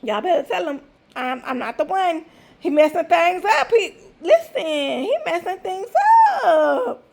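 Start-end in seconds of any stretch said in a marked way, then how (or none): none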